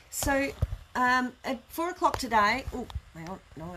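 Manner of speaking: ordinary delivery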